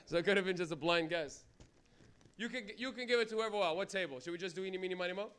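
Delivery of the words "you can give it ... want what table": normal talking voice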